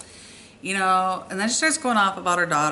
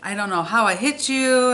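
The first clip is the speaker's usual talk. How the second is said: higher-pitched retold distressed apology, no real tears; imitating crying apology; 6 semitones higher than usual. Shaken girl apologising to the driver she hit, mock-upset. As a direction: mimic a shaken apology in a raised voice. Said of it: "she's shaken up and she's crying".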